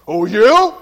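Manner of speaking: loud, indignant challenge at his wife; mock loud retort. Husband out-shouting his wife in an argument, loud indignant retort. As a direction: bark it out like a husband who knows he can outshout her. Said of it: "So you can get real loud".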